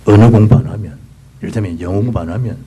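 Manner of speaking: ordinary talk, nothing marked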